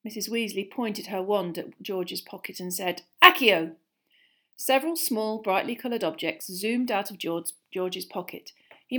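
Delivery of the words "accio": loud, firm incantation; forceful summons; commanding spell. Acted. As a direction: cast it like an order. Mother casting a summoning spell.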